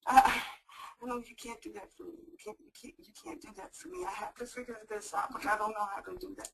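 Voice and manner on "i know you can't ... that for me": much softer, almost whispered; soft resignation. Acted. Caller tells helper she must decide herself, softly. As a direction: quietly give up.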